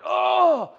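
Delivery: high, loud "oh" of pain; mock pained cry. Preacher re-enacting his stubbed toe, pained cry. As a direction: yelp as if the toe just cracked. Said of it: "And I'm like".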